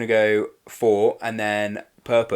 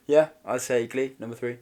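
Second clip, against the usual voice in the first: ordinary talk, nothing marked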